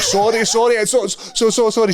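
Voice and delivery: high, tumbling repeated sorries; mock-frantic pleading, pitched right up; panicked apology. Pupil apologising to the furious teacher, panicked pleading.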